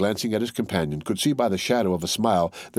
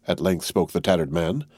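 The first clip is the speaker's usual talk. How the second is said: ordinary delivery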